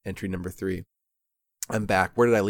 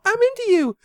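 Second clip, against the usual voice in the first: high, put-on girl's voice; smitten girl; 4 dB louder than usual. A narrator's reading. Girl insisting to the uninterested narrator, high mock confession. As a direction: mimic her confession way up high. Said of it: "But she's all".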